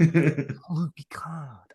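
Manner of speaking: slow, drawn-out French "Jean-Luc Picard" with a French r; a light French pronunciation of "Jean-Luc Picard"; French accent. Retold or imitated. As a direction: savour each syllable the French way. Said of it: "by the French accent".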